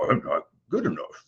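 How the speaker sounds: lightly put-on Cookie Monster voice; silly monster voice for a negative thought. Shown as a demonstration. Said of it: "using a Cookie Monster voice".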